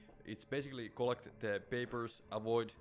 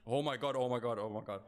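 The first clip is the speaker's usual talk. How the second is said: panicking gamer; 5 dB louder, faster than usual. Real, not acted. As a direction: blurt it out in fright. Enemy right there, panics.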